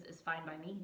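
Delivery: ordinary delivery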